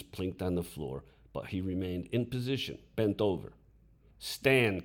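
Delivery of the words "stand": loud one-word order; stern command. A narrator's reading. Teacher ordering the caned boy to stand, stern command. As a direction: snap the order out. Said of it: "commanded Dickie".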